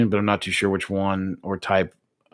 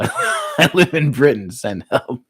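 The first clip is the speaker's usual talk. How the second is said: higher, slow, breaking up laughing; laughing read-out; 4 semitones higher, slower than usual. Real, not acted. Streamer cracking up at a viewer's plea, laughing. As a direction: read it out through real laughter.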